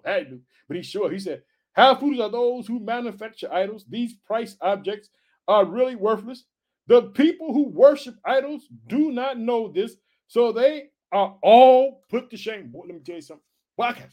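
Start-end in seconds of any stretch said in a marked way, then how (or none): 1.7-12.7 preaching scripture. Acted. Reads scripture, building.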